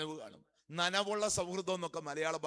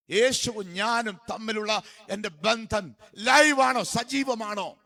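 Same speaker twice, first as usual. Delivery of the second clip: loud, high challenge from the pulpit; booming sermon exhortation; full-force preaching; 6 semitones higher, 13 dB louder, slower than usual. Real, not acted. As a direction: press the congregation hard with the question.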